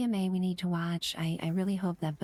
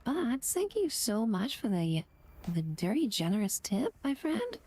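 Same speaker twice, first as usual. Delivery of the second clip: higher, emotional gushing thanks; touched and excited, higher voice; overwhelmed thanks; 6 semitones higher than usual. Real, not acted. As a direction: thank him as if near happy tears. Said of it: "I'm gonna cry".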